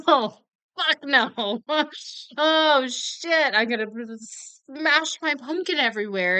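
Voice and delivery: half-laughing inner freak-out; inner panic. Retold or imitated. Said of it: "On the inside, I'm gripped with a deep, deep visceral fear".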